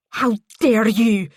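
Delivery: loud, slow outraged cry; wounded outrage, drawn out; indignant cry. A narrator's reading. Paisley outraged at Ellie, indignant cry. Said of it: "she cried".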